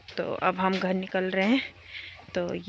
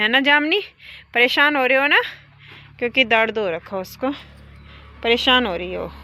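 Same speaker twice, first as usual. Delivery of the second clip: coaxing; 3 semitones higher, 9 dB louder than usual. Real, not acted. Talking to the hurting cow.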